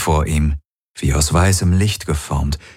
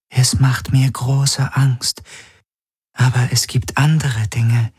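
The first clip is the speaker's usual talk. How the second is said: fearful whisper; 6 semitones higher than usual. A narrator's reading. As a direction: whisper the fear. Frightened confession.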